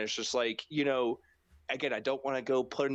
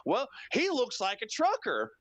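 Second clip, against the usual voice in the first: put-on high, dismissive old-announcer voice; mocking J R; 11 semitones higher than usual. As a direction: imitate the clueless commentator. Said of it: "J R goes".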